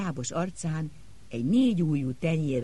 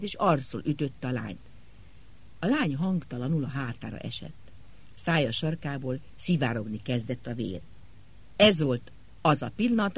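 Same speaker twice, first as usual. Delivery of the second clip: ordinary delivery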